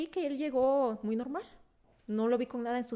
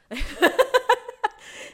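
high loud laugh; 11 semitones higher, 10 dB louder than usual. Real, not acted. Laughs at herself.

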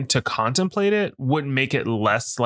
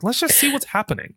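high mock calm; 12 semitones higher than usual. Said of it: "it's like".

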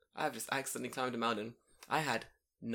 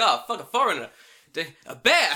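loud, raised cry of alarm; hammed-up fright, building to a shout; mock panic; 5 semitones higher, 14 dB louder than usual. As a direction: imitate a terrified kid spotting a monster. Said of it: "I accidentally scared some children".

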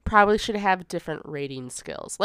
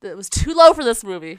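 louder, insisting again; insistent; 8 dB louder than usual. Real, not acted. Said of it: "repeats point louder".

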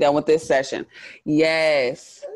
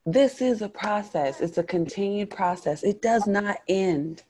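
preaching; 6 semitones higher, 3 dB quieter than usual. Acted.

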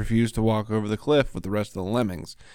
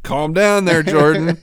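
laughing; 7 semitones higher, 5 dB louder than usual. Retold or imitated. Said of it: "it's like".

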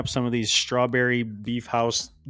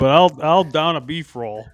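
put-on Southern accent; 4 semitones higher, 7 dB louder than usual. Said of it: "do an impression of us".

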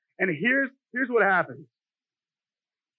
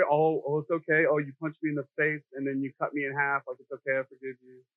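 softer, limp doormat voice; mock a meek pushover forgiving everything; wussy pushover voice; 6 semitones lower, 5 dB quieter than usual. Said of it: "like, very".

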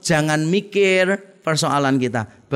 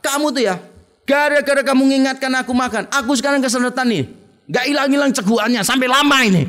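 raised, higher complaining rant; angry boyfriend; 6 semitones higher, faster than usual. Acted. Boyfriend scolds girlfriend on the phone, angry and raised.

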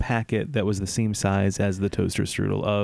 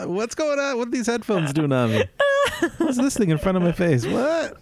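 hammed-up confused waking, higher; mock bewilderment; 10 semitones higher than usual. Co-host pretends to wake up lost mid-podcast, hammed-up startled. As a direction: act startled to find yourself on mic.

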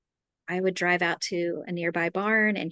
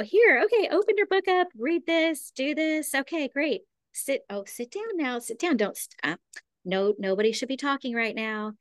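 high-pitched mock bossy teacher; imitating a teacher ordering a class about; authoritative teacher; 7 semitones higher than usual. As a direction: rattle off orders like an overwhelmed teacher. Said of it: "that authoritative teacher".

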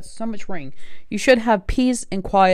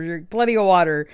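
normal talking voice

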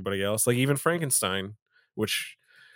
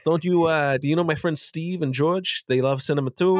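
loud, high gushing film director voice; Martin Scorsese impression; 6 semitones higher, 4 dB louder than usual. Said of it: "my Marty Scorsese impression".

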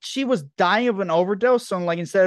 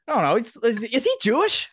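normal talking voice

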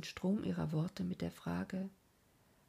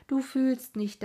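higher and louder repeat, stress on du; emphatic outburst; 6 semitones higher, 8 dB louder than usual. A narrator's reading. Narrator crying out against the madman's fate, loud. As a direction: repeat it as an anguished burst.

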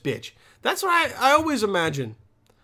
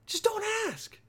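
squeaky plea; 8 dB quieter than usual. Real, not acted. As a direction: plead it in a tiny high voice. Urging people not to ask.

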